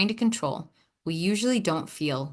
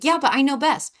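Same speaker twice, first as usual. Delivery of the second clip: know-it-all protest; 6 semitones higher, 4 dB louder than usual. Acted. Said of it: "when it's like".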